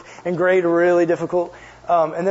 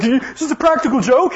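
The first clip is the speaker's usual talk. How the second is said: suspicious stranger; 7 semitones higher, 4 dB louder than usual. Retold or imitated. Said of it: "He's like".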